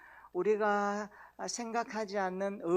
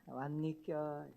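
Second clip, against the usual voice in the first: 왔니껴 drawn out slowly and softer; slow Andong greeting; 3 semitones lower, 5 dB quieter, much slower than usual. Shown as a demonstration. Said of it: "이렇게 인사해요". (안동 사투리로 천천히 "왔니껴")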